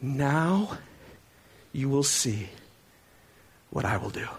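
measured, low divine promise with long pauses; voice of God. Acted. God answering Moses after his complaint, solemn and hushed. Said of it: "then the Lord says to Moses".

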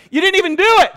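high, loud incredulous outburst; indignant shout. Acted. Preacher protests shame over mere temptation, shouting indignantly. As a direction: shout it in disbelief.

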